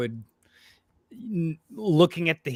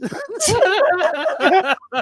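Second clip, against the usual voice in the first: high, cackling real laughter; big laugh; 4 dB louder than usual. Real, not acted. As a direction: burst out laughing at the joke. Laughing at the joke.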